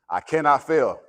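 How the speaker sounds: loud, punching each word; shouted affirmation. Shown as a demonstration. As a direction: call it out for the room to repeat. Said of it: "say that and believe that".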